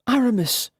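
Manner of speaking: eager shout of greeting; shouted name. A narrator's reading. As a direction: call out to him joyfully across the room. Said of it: "she shouted".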